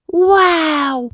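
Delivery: delighted amazement; surprised. A narrator's reading. Bus JJ hearing of the Queen's invitation, amazed. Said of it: "J J was so surprised".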